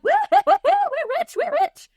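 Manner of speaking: hammed-up giddy crowing; gleeful whooping. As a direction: cheer like a getaway driver who struck gold. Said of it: "driving the car like a maniac".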